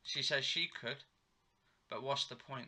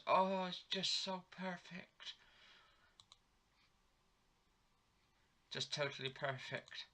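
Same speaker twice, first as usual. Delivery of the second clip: dreamy imitated man; 6 semitones higher, 3 dB quieter than usual. Retold soap, man tasting food.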